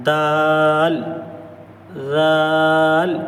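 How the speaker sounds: each letter stretched long and clear; drawn-out letter demo. Shown as a demonstration. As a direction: hold each letter sound like a recitation teacher. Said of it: "زبان کی نوک ... کنارے پر لگانا ہے".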